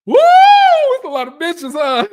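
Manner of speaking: high, loud, drawn-out whoop of disbelief; amused yell. Real, not acted. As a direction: shout it out, cracking up at the bitches. Cackling reaction after Charles impression.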